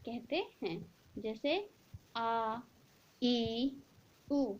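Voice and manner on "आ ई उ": आ, ई, उ held long; long vowels. Shown as a demonstration. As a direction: stretch आ ई उ to double length. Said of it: "ह्रस्व से दुगुना समय लग रहा है". (दीर्घ स्वर लंबा खींचकर)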